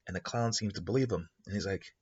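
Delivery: ordinary delivery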